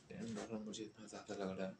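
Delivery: ordinary talk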